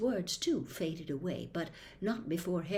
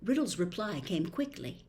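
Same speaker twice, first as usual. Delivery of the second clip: usual speaking voice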